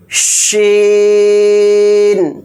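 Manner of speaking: long held shīn, slow and even; drawn-out letter name. Shown as a demonstration. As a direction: stretch شین out for the class to copy. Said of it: "بڑے شین کے اوپر تین نقطے ہوتے ہیں". (شین کو لمبا کھینچ کر پڑھیں)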